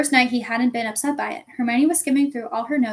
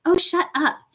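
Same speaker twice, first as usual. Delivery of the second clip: higher, louder protest; annoyed retort; 6 semitones higher, 5 dB louder, slower than usual. A narrator's reading. Girl answers friend's mocking impression, annoyed. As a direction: cut the teasing off crossly. Girl snaps back at mockery.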